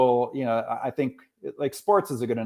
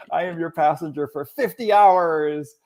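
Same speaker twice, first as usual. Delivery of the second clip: ordinary delivery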